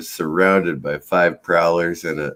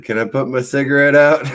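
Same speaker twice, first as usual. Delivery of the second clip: raised-pitch casual question, retold for laughs; innocent, unworried ask, pitched up; unfazed request; 7 semitones higher, 4 dB louder than usual. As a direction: ask it lightly, as if nothing is wrong. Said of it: "my heart rate never even increased".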